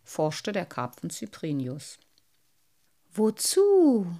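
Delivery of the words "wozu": high, loud surprised echo. Acted. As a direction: caught off guard by the question. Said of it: "wiederholte der kleine Wassermann überrascht".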